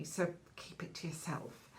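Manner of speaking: whispered gossipy warning; confidential hush. Acted. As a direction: drop to a whisper, sharing a secret. Confiding a secret to listener.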